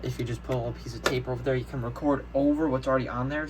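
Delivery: ordinary talk, nothing marked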